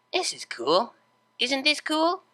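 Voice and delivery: dreamy, rising to a high giddy question; delirious unicorn. Acted. Vinyl, slack in Octavia's hooves, giddy and dreamy. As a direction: marvel like a loopy child.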